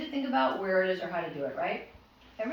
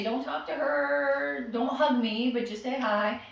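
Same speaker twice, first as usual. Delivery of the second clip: slow, careful instructions; 3 semitones higher, slower than usual. Shown as a demonstration. Owner briefing guests, slow and deliberate. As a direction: slowly spell out the rules.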